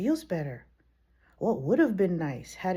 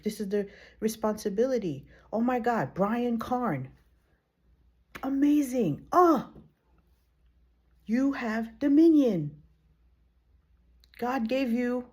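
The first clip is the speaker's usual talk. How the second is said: ordinary talk, nothing marked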